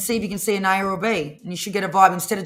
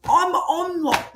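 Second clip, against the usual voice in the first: imitating Dad; 8 semitones higher, 3 dB louder, much slower than usual. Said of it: "He's like".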